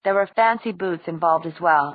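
plain reading voice

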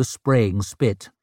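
ordinary delivery

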